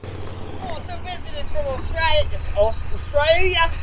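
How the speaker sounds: put-on a high, unsteady try at an Australian accent; Australian accent attempt. Farmer greeting visitors from Australia in a shaky Australian accent. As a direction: play the visitor and attempt Australian, not quite landing it. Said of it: "you gotta work on that accent".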